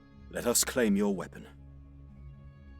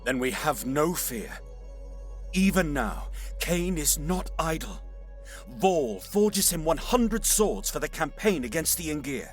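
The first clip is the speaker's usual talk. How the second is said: higher-pitched, stirring declaration; rousing war talk; 5 semitones higher than usual. Acted. Warrior to seer on the coming war, rousing. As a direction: proclaim it with martial conviction.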